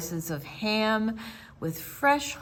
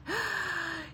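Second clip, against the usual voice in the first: gasp; 9 semitones higher, 8 dB quieter than usual. Acted. Dragon about to sneeze.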